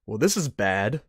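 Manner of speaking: ordinary talk, nothing marked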